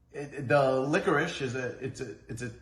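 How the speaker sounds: soft, halting false starts; stuttering. Real, not acted. Host tripping over the candy description, stuttering. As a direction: stumble and restart on small words. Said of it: "I'm stuttering a lot today".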